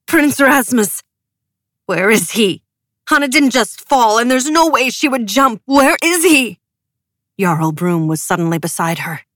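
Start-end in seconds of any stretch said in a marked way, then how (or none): 0.1-6.6 higher, louder furious demand; enraged grieving outcry. A narrator's reading. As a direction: demand the prince be found. Nina's furious demand over Hanna.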